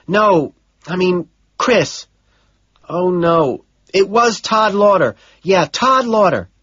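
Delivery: a secretary passing on messages; Gene's voice. Acted. Secretary corrects herself on phone.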